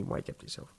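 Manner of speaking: whispered aside to himself; whispered self-rebuke. Real, not acted. Scolding himself mid-story, whispered. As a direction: breathe it out, telling yourself off.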